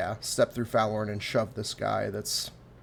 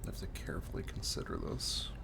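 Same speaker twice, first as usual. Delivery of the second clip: whisper; 4 semitones lower, 8 dB quieter than usual. Real, not acted. Player muses quietly to himself.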